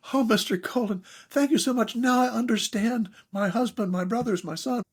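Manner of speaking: high, lightly voiced thankful woman; grateful woman. Mimicking a woman thanking him after a workshop, high and grateful. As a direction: imitate a grateful woman, pitched well up. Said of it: "women come up to me and go".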